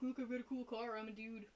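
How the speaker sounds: lightly voiced dude impression; Cody. Mocking Cody showing off his Mustang, lightly imitated dude. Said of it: "that's my Cody impression".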